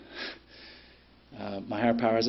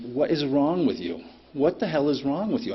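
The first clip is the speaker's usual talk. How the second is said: higher, exasperated demand; retold losing his temper; 5 semitones higher than usual. Trucker to the tailgating driver, retold angry outburst. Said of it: "And I lost my shit".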